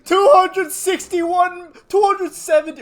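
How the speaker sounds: loud, high, slow victory yell; triumphant shout. Real, not acted. Gamer telling his boys they hit number one, triumphant shout. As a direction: shout it like you just won.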